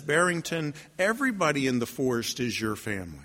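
low, softened wolf voice; quiet, grave pronouncement; wise wolf. Acted. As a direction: reassure gently in a low register. Wolf consoles the lonely bunny.

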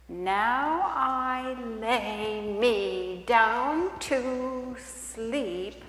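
put-on very slow, halting child's bedtime prayer; hesitant bedtime prayer. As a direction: feel your way through the words slowly. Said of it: "an appropriate prayer".

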